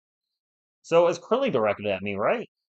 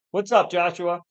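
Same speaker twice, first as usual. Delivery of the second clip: usual speaking voice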